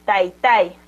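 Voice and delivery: TAI, TAI with the second TAI carrying the force; stress on last syllable. Shown as a demonstration. Teacher repeating for learners, second TAI stressed. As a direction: put the weight on the final TAI. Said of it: "Lo repito".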